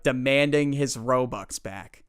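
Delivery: usual speaking voice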